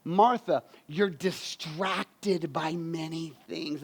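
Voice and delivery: slow, soft, tender rebuke; slow gentle rebuke. Acted. Jesus to Martha, slow soft gentle rebuke. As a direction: let it land gently on her. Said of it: "He says this in that scripture".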